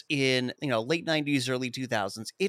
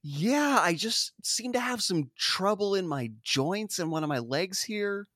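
higher, put-on complaining voice; lightly hammed-up greeter in pain; 7 semitones higher than usual. Greeter setting up a pun about sore joints, mock pained. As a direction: play up a sore leg for a gag.

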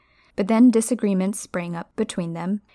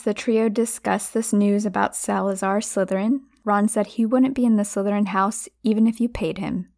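usual speaking voice

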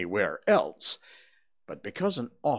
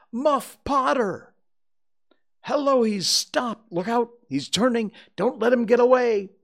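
high, loud cries of alarm; crowd shouting; 14 semitones higher, 9 dB louder than usual. A narrator's reading. Crowd seeing Muff Potter turn, urgent shouting. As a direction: yell a warning across a crowd. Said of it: "from twenty voices".

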